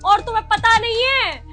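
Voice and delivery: loud furious rant; angry shouting. Acted. Wife raging at husband, threatening court, loud and angry. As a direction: scream it out, beside herself.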